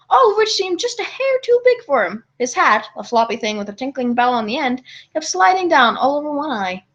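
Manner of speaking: plain reading voice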